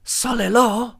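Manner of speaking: high, mock-foreign shop-girl voice; counter girl. Imitating the counter girl asking about the order, high and puzzled. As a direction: squeak it out as a confused question. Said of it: "and she goes".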